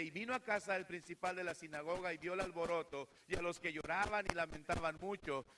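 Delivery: ordinary talk, nothing marked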